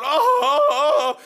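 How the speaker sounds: very high laughing moans that fall back down; hammed-up moans of a man with broken ribs; mock pained moaning. Preacher acting out his groans from broken ribs, laughing. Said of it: "I'm sitting down there with three broken ribs going".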